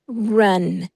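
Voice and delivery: ordinary talk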